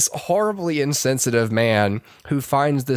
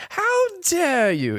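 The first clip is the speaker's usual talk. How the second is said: high, slow, hammed-up "how dare you"; a scandalised old-film lady; mock outraged gasp; slower than usual. To the listener, mocking the trailer's stilted outrage. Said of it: "you're like".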